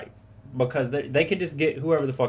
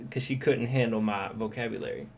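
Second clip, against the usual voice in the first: normal talking voice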